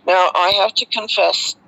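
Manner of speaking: ordinary talk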